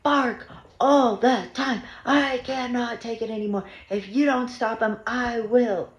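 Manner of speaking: mimicking angry neighbor. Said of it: "he was like".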